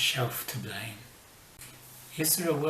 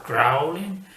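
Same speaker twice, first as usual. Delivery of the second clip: mock growl; 3 semitones higher, 10 dB louder than usual. Dog growling at bad guy.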